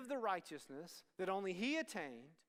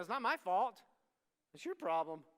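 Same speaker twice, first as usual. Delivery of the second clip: louder defensive retort; defensive back-talk; 7 dB louder than usual. Pretend. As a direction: snap back like a teen. What we would say back.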